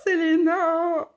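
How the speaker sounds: high, loud, drawn-out cry of a name; excited call. Real, not acted. Host greeting a viewer, delighted loud cry. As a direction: call her name out in glee.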